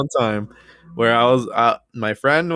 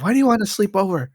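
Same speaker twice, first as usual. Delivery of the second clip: high, fast nagging mom voice; mock mom interrogation; imitating mom; 7 semitones higher, faster than usual. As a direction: quiz him like a suspicious mother. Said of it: "she would just be like".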